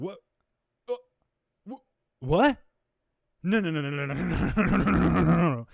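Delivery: hammed-up panic as if cornered online; imitating a rattled arguer spiralling into denial; mock panic. Imitating the flustered opponent.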